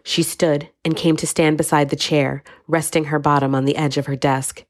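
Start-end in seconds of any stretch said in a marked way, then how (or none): none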